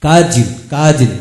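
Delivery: "কাজিন" slow with a short a sound; drawn-out "কাজিন" for learners; slow model pronunciation. Shown as a demonstration. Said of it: "এরূপ এতগুলো উচ্চারণ হয়". (ধীরে ধীরে "কাজিন")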